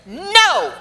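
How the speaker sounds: high, loud, drawn-out refusal; emphatic imitated refusal. Actress imitating Ryan refusing her French accent, emphatic refusal. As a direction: imitate a boss flatly vetoing the idea, loudly. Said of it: "Just like that".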